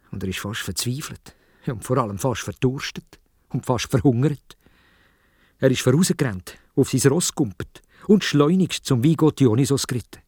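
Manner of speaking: ordinary delivery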